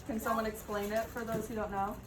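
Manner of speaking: normal talking voice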